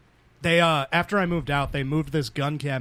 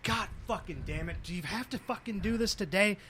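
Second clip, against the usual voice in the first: grumbling dad; 3 semitones higher, 10 dB quieter than usual. Retold or imitated. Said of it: "he's like".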